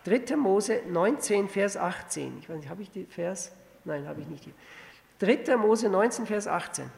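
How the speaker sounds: ordinary talk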